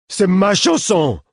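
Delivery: high, loud, slow wail of a simple man; childlike shout. A narrator's reading. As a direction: yell it like an outraged child. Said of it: "hurla-t-il". (hurlé d'une voix aiguë d'enfant)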